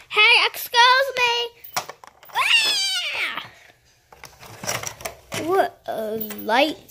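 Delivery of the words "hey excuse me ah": startled scream. Pretend. Driver leaves without payment.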